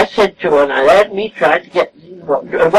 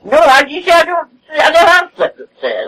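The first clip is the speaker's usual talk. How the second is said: tearing up as she admits it; real tearful break; 10 semitones higher than usual. Real, not acted. Telling the interviewer she never sleeps upstairs, with a real tearful break. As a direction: voice cracking high into tears.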